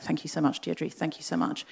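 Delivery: soft, breathy-free murmured thanks; a quiet, sincere thank you; hushed gratitude. Retold or imitated. Grateful thanks to banker.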